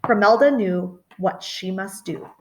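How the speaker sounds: ordinary delivery, nothing marked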